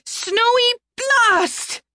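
high, slow spell shout. A narrator's reading.

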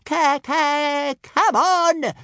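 loud, very high stuttering squawk; indignant bawl. A narrator's reading. Little dragon hurrying the others on, bawling indignantly. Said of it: "bawled Toothless indignantly".

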